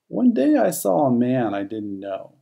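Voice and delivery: plain reading voice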